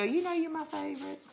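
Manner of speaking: high, soft grandmotherly coo; lightly voiced doting grandma, telling the grandson he is her favorite; sweet grandma voice. Grandma whispering to her grandson behind everyone's back, sweet high coo. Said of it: "she'd go".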